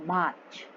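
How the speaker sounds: मार्च as MAACH, R nearly silent; softened R. Shown as a demonstration. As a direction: barely sound the R in मार्च. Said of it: "silent थोड़ा सा कम बोलना है".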